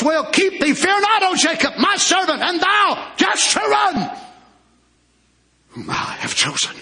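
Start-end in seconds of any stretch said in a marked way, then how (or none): 0.0-4.2 preaching at full force. Acted. Pastor booms God's word to his congregation, full-force preaching. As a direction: thunder the promise out to the congregation.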